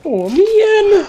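high, loud "oh man"; shocked cry. Real, not acted. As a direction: exclaim in shock. Reacting to a dark joke.

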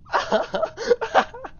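laughing shriek; squealing laugh after escape; high burst of laughter. Real, not acted.

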